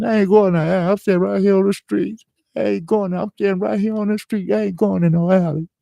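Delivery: very high, loud sobbing plea; crying refusal. Retold or imitated. Begging robbers he's staying on the street, high loud sobbing. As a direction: wail it like a terrified kid. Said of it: "I started crying".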